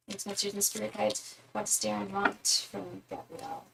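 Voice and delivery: soft, repeated chant-like question to the spirits; murmured invocation. Real, not acted. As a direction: quietly intone it like a prayer while concentrating. Repeats invocation over the cards.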